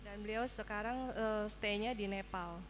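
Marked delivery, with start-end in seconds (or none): none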